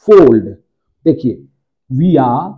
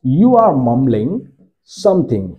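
very slow, word by word; slow model sentence; much slower than usual. Shown as a demonstration. Teacher slowly modelling the sentence for students. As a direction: spell it out slowly for the class. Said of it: "model sentence".

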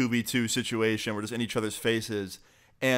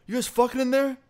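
high-pitched, indignant mock yell; retold yelling; 12 semitones higher, 3 dB louder than usual. Bystander scolds a couple in the bathroom stall, mock yelling. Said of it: "So he starts yelling".

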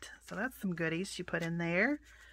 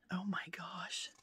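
whisper; 7 dB quieter than usual. Real, not acted. Whispered awe.

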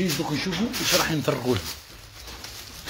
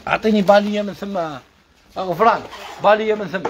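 calling out; 7 semitones higher than usual. Real, not acted. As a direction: shout to a helper for the item. Calling out for an item off-mic.

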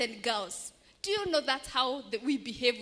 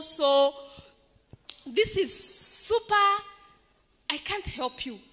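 loud, indignant complaint; defiant refusal; 6 dB louder than usual. Acted. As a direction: let the grievance boil over. Beggar refusing wealthy man.

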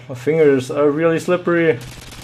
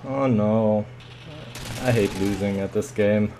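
normal talking voice

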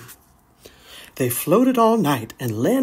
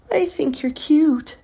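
put-on much higher shy girl voice; sweet young doe; 11 semitones higher than usual.

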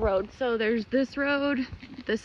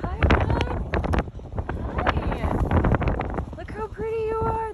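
gushing over the horse in a much higher pet voice; cooing to a horse; 7 semitones higher than usual. Real, not acted. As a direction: coo at him like a sweet pet.

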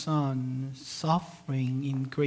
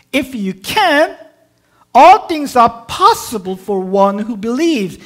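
forceful reply; 7 semitones higher, 15 dB louder than usual. Acted. Jesus answers the father, preached.

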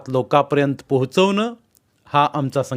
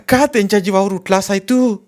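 high, louder shouted protest; shouting; 8 semitones higher, 5 dB louder than usual. Acted. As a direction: yell at him to stop. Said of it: "Saibai ओरडली".

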